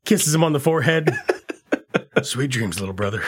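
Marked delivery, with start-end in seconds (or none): 2.2-3.2 soft tender goodnight. Retold or imitated. Hitman tucking victim in.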